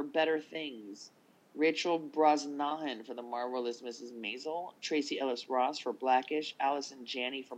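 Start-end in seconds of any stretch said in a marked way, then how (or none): none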